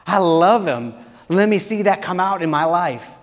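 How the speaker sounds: building to a loud, high triumphant cry; freed believer shouting praise; joyful declaration. Acted.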